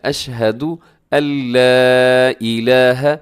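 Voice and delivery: slow recitation, अल्ला with a light merged l; careful model reading with light अल्ला; thin lam recitation. Shown as a demonstration. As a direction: stretch अल्ला and keep its l thin. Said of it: "इदगाम के साथ आपने इसको बारीक रखना".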